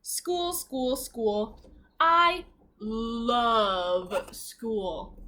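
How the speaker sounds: slow, stretched storybook reading; savoring the words of the book; drawn-out read-aloud. Acted. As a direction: draw each word out for the children.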